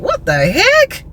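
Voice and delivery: high, drawn-out incredulous question; retold bafflement. Woman reacting to the salesman's accusation, baffled and incredulous. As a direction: stretch it out slowly, pitch jumping up.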